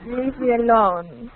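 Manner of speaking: put-on slow, dismissive French-accented brush-off; haughty mock-French accent, drawn out; snobby French accent. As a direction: play a French snob, stretching the words. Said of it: "It sounds very snobby".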